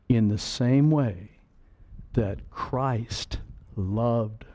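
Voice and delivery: slow, soft, with long dramatic pauses; slow solemn scripture reading with pauses. Shown as a demonstration. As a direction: let each phrase hang in the silence. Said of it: "dramatic pause and silence".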